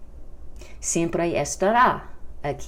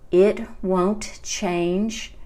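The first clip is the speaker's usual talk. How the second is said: "It won't change" word by word; slow model phrase; 3 semitones higher, much slower than usual. Shown as a demonstration. Teacher modelling the contraction won't for learners, slow and deliberate. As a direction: say "It won't change" very slowly, spaced out.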